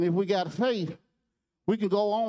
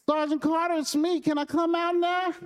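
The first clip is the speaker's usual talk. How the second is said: put-on high, pleading child's voice; a small boy asking to be let out; little boy voice; 11 semitones higher than usual.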